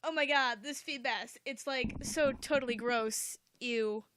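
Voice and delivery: loud, grossed-out fisherman; disgusted. Acted. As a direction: sneer at the ugly fish, loudly. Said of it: "be disgusted".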